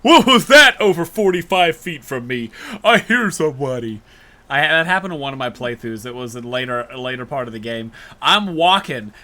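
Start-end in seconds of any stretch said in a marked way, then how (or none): 0.0-4.0 louder, oddly voiced guard; mock a guard who hears too much; suspicious guard. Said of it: "stupid moments where it's like".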